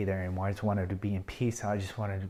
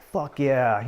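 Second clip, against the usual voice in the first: relieved exclamation; 5 semitones higher, 7 dB louder than usual. Retold or imitated. Relief at finding himself intact.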